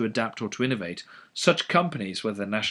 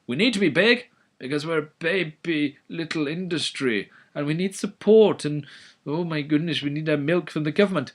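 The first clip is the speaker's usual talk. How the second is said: put-on high, babyish plea for government help; whiny infant industry; 5 semitones higher than usual. Lecturer voicing an infant industry begging, babyish whine. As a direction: play a big firm pretending to be a baby. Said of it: "infant industry argument".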